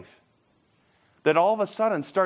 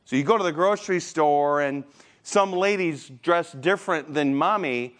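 normal talking voice

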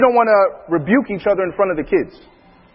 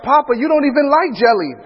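higher-pitched child imitation; young daughter; 7 semitones higher than usual. Daughter teasing her father about jelly, high imitated child. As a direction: play a little girl calling out Papa. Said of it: "my daughter's like".

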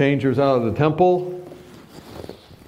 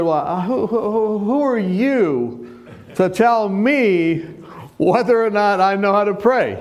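indignant; 8 semitones higher than usual. Retold or imitated. Indignant retort to Tony.

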